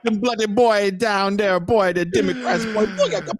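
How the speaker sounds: high, loud laughing Jamaican toast; Jamaican accent. Retold or imitated. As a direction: hype it like a dancehall DJ. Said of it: "[name] doing his show constantly".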